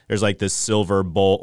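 normal talking voice

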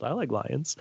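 put-on soft, lightly childlike voice. Shown as a demonstration. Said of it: "now I sound like my five-year-old".

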